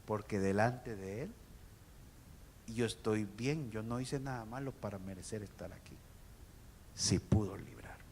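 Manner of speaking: low, hushed reply; quiet voice sinking to a whisper; answering voice. Acted. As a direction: answer softly and calmly from below. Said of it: "se oyó una voz que dijo".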